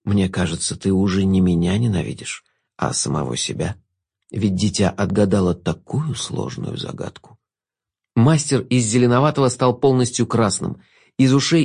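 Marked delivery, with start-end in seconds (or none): 0.0-7.4 velvety voice. Acted. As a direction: purr it innocently, very low and soft. Jester continues. (бархатный невинный голос)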